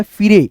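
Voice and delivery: फिरे read letter by letter, "fi-ray"; wrong reading. Shown as a demonstration. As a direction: misread fire as फिरे. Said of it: "गलत, गलत".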